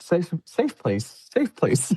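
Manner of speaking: high, slow, rattled repeating of safe place; panicked muttering. Retold or imitated. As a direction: chant it to yourself in a daze. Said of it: "I was just like".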